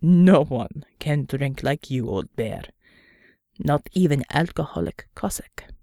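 low, slow, weary gruff man. Acted. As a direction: drag it out, low and tired. Unter teases Pavel.

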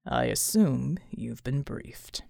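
very low, slow, unruffled voice; ridiculously calm. A narrator's reading. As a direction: take your time, deep and composed. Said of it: "sounding ridiculously calm".

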